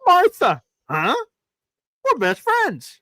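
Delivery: high mock movie dialogue. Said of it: "three new voices that I've never heard you do".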